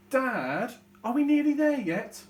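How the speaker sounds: high, loud whine; whining child. Shown as a demonstration. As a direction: drag it out like a bored kid. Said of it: "change my voice so that you can tell that I'm a different character".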